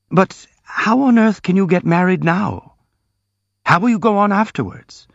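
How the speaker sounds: high, alarmed questioning, a touch aghast; horrified. A narrator's reading. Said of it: "asked the prince, horrified".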